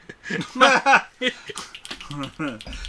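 a burst of laughter; loud laugh. Real, not acted. Laughing at the beat poet.

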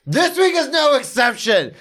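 big, slow, high hype-man announcement; announcer intro. Acted. Host introducing his guest, booming emcee build-up. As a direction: bring the guest on like a ring announcer.

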